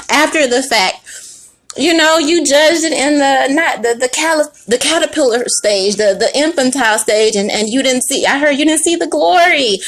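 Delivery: ordinary delivery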